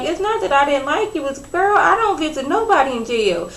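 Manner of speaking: mildly hurt, insistent mock protest; retold upset protest. Mom reassuring Remy she likes her, imitated upset protest. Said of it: "upset that you felt".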